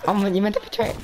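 high, cracking complaint. Real, not acted. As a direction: voice breaks on the rebuke. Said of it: "my voice broke".

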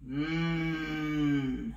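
a hungry crocodile savouring the thought; murmuring crocodile licking its lips. Acted. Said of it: "murmured hungry Crocodile".

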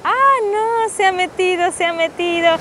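high startled "ah no"; sudden alarmed exclamation; surprised cry. Real, not acted. Husband enters cold sea.

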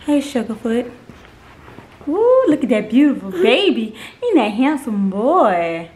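ordinary talk, nothing marked

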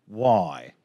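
ordinary delivery

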